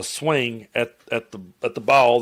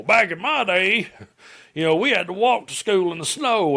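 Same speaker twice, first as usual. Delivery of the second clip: mocking old-timer; 7 semitones higher than usual. Vlogger mocking a preacher's son's old-school attitude, whiny imitation. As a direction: mimic a smug old-timer going on about the old days. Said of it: "I could so see him being one of these people".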